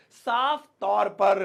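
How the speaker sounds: "साफ तौर पर" slowly, word by word; slow repetition. Shown as a demonstration. Teacher telling students what clearly means, slow and measured. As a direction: space out and lengthen "साफ तौर पर".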